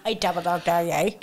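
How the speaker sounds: imitated Derry catchphrase; Derry accent. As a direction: mimic your friend's Derry dare. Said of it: "when you do that accent".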